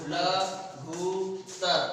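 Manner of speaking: लघुत्तर drawn out very slowly, each sound held; teacher slowly sounding out लघुत्तर; slow spelled-out demo. Shown as a demonstration. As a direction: stretch लघुत्तर out for the class. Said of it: "तो ये बन जाएगा हमारा".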